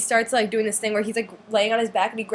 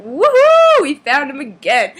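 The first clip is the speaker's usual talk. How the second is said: high, loud whoop of joy; squealing fangirl cheer, then a slower gloat; excited cheer; 5 semitones higher, 11 dB louder, slower than usual. Retold or imitated. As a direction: cheer like you just found your idol again. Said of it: "And we're like".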